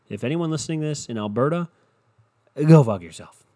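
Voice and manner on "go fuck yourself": much louder, blasting it at them; loud mock-angry outburst; shouted curse. Real, not acted. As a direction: yell it at the listeners. Cursing out Alberta fans.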